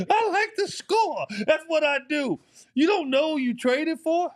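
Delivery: half-laughing outrage at a coach's question; indignant outburst. Retold or imitated. Retelling his outraged reaction to coach.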